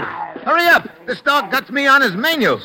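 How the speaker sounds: high, loud urgent yell; shouting. Acted. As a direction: shout for someone to pull the dog off. Pinned down by the dog.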